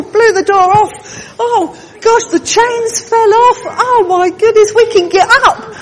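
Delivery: high, loud, laughing cry of freedom; excited. Acted. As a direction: burst out laughing as the chains fall. Prisoners freed, acting it out.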